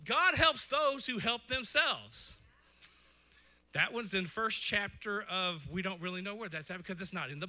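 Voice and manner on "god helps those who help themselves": slow quoted saying. Retold or imitated.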